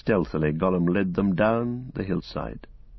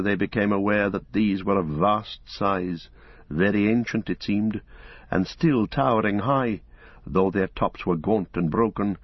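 plain reading voice

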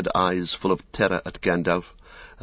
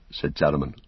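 ordinary delivery

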